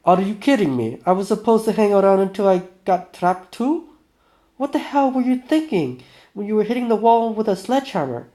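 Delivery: loud, high, indignant outburst; Vinya. Acted. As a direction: lay into him in outraged disbelief. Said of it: "this is Vinya".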